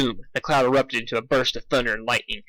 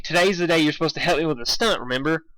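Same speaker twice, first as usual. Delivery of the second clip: plain reading voice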